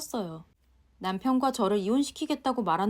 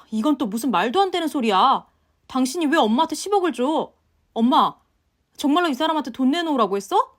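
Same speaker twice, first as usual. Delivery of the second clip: louder, higher incredulous questions; baffled husband; 4 semitones higher, 7 dB louder than usual. Acted. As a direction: ask in disbelief what his mother did. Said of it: "황당하다는 얼굴로 묻더라고요". (황당해하는 남편)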